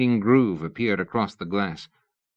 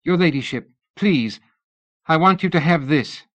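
higher and louder, urgent; urgent plea; 8 semitones higher, 5 dB louder than usual. A narrator's reading. Missus Tournachon offering Lady Ada a ring, urgent. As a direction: press the gift on her.

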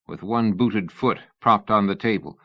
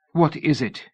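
pleading; 7 semitones higher than usual. A narrator's reading. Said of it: "she pleaded".

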